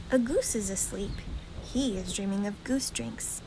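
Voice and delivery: usual speaking voice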